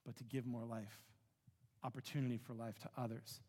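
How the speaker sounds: soft, low searching prayer; quiet prayer. Acted. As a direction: ask God quietly, from the heart.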